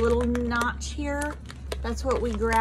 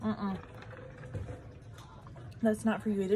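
normal talking voice

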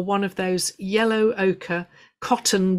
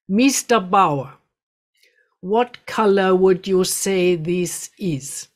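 put-on slow, careful German-tinged broken accent, a bit louder; 4 dB louder, slower than usual. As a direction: let each word hang in a slow broken German accent. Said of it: "said slowly in his broken accent".

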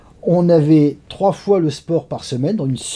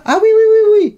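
high, loud string of yeses; mock eager, pitched right up; over-eager agreement; 6 dB louder than usual. Staff brushing off a request to bring the scale, over-eager yeses.

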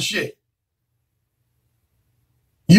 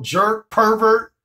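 ordinary talk, nothing marked

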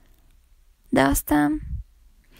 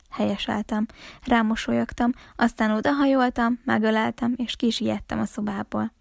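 ordinary delivery, nothing marked

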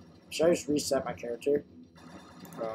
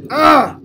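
loud high yelp; sharp scream; 11 semitones higher, 15 dB louder than usual. Real, not acted. Player hit yells sharply.

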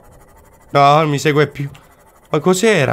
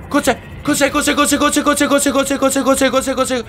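high rapid-fire repeated question; machine-gun the question in fright; panicked babble; 11 semitones higher, faster than usual. Real, not acted. Panics at unknown creature.

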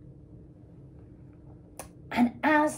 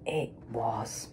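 hushed; 13 semitones lower, 14 dB quieter than usual. Acted. Dramatic reveal in story.

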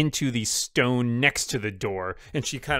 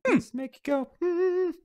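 mock catchphrase. Quoting a catchphrase jokingly.

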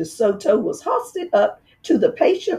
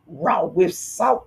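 slow, softer dark muttering; grim slow repeat; 3 dB quieter, slower than usual. Acted. Dentist grimly repeating the fox's dream, slow. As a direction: repeat it as it dawns on you.

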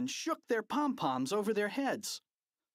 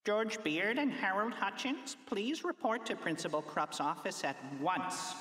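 higher-pitched school PA voice; loudspeaker announcement; 7 semitones higher than usual. A narrator's reading. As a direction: make an official announcement over the loudspeakers. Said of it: "an announcement came over the loudspeakers".

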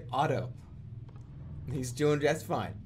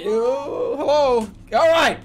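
shouting; 10 semitones higher, 11 dB louder, much slower than usual. Real, not acted. Startled greeting shout.

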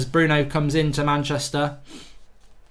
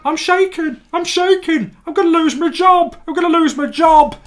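high, loud, trembling panic on "I'm shaking"; panicking Jesse Lingard; 14 semitones higher, 6 dB louder than usual. Acted. Lingard hearing of Bruno's signing, panicking high. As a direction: squeal in terror about losing your job. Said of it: "this is Jesse Lingard when he hears".

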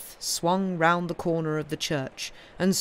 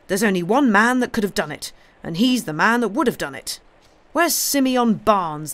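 raised, loud insistence; obstinate; 5 semitones higher, 7 dB louder than usual. A narrator's reading. Cobbler accusing the blacksmith, obstinate. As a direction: press the accusation stubbornly, higher and louder. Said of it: "repeated the cobbler obstinately".

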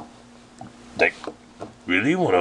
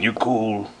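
normal talking voice

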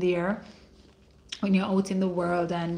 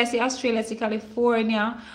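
ordinary talk, nothing marked

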